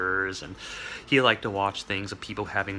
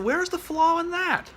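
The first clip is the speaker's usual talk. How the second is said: much higher, slow, lightly voiced Sparky; Sparky asking; 4 dB louder than usual. Sparky handing over his diagram, high and smug. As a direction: quiz me like a smug genius. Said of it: "he handed it to me and said".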